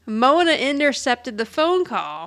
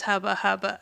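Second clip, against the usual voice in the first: laughing hubba hubba; 2 semitones lower, 4 dB quieter than usual. Retold or imitated. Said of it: "I think he says".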